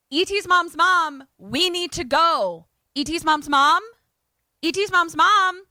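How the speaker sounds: loud, high mock yelling upstairs; urgent calling out. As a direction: imitate searching the house, calling out urgently. Running upstairs calling for grandmother.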